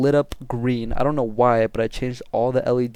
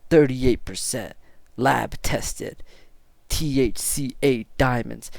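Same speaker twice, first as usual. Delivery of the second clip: slow, measured retold sales spec; imitated dealer; slower than usual. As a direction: lay out each word slowly like a dealer. Quoting the dealer's product pitch.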